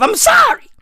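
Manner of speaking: high, loud, strained cry of sorry; shrieked apology. Acted. Ranting to the cat community, shrieking sorry. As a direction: wail the apology at the top of your voice.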